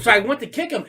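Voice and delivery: ordinary talk, nothing marked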